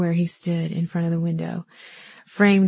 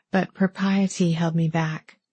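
usual speaking voice

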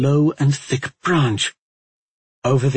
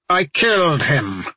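growled; 5 semitones higher, 4 dB louder than usual. Acted. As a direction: snap it out in anger. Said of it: "Talmadge growled".

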